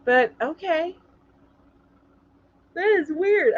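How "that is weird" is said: said through a yawn. Real, not acted. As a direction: yawn while talking. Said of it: "I'm sorry for the yawn".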